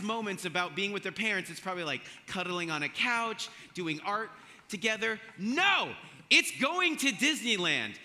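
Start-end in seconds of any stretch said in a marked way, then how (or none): 5.4-7.9 emphatic no. Real, not acted. Emphatic punchline contradicting parents.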